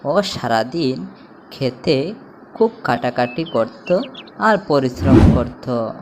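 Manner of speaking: usual speaking voice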